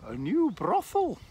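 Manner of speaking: very high, loud squawking parrot; high cheeky talking bird. Acted. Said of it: "the parrot says".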